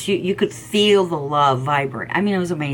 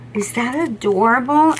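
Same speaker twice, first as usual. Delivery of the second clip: excited; 6 semitones higher, 4 dB louder than usual. Real, not acted. Showing cute stickers.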